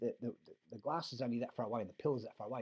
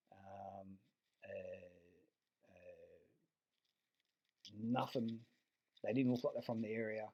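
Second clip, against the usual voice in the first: ordinary delivery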